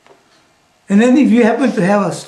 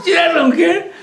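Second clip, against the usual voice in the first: much higher, through laughter; amused repetition; 10 semitones higher, slower than usual. Real, not acted. Teacher amused by TV woman's accent, laughing. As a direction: say it high, still laughing. Laughingly repeats her admiring remark.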